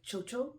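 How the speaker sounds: name chocho the Jamaican way, both syllables alike. Shown as a demonstration. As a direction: say chocho as CHOH-choh, two even syllables. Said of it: "So in Jamaica, we call it".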